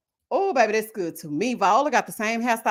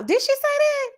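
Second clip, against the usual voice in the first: surprised. Real, not acted. Surprised at a comment.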